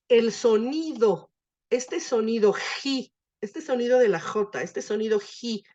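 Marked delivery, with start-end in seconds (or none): 2.5-3.1 "ji" slowly, with a throaty aspirated j; guttural aspirate. Shown as a demonstration. Teacher isolates the sound for student, "ji" as guttural aspirate. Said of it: "que es un sonido gutural". 5.2-5.6 "ji" with an aspirated j from the throat; guttural aspirate. Shown as a demonstration. Teacher demonstrates this sound to student, "ji" as guttural aspirate. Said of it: "que es un sonido gutural".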